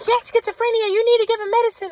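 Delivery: high-pitched caricature of a worried mother; mom impression. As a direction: squeak it out as his insistent mom. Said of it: "My mom was like".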